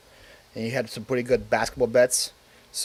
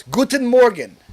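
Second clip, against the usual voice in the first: German greeting; 8 semitones higher, 7 dB louder than usual. Shown as a demonstration. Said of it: "That's the word".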